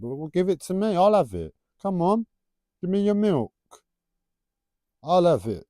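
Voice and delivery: loudly snatch the milk away; mock grab.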